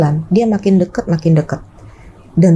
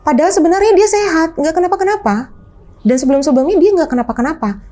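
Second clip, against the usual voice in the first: usual speaking voice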